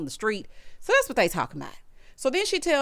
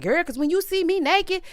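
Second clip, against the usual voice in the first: Marie quoted; 9 semitones higher than usual. Retold or imitated. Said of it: "she was like".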